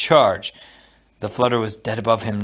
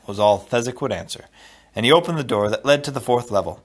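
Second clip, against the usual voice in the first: usual speaking voice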